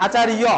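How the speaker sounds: আচার্য as "achariyo"; Sanskrit pronunciation. Shown as a demonstration. Teacher contrasts the Sanskrit for pupils, Sanskrit pronunciation. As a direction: sound the য in আচার্য as "iyo", no "j". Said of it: "সংস্কৃত হচ্ছে আচার্য".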